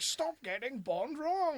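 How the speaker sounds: high-pitched, drawn-out mock outburst; stretched, high imitation of a comic meltdown; exasperated outburst impression. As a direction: quote it with exaggerated exasperation, high and slow. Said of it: "It's just like".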